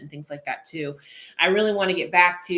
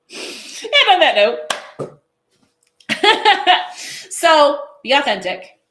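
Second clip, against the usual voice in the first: laughing; 9 semitones higher, 9 dB louder, much slower than usual. Real, not acted. Laughing at own hiccup joke.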